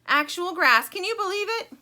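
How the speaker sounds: loud, thrilled character shout; yelling. A narrator's reading. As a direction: blare it out in high excitement. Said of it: "yells the thing in the box".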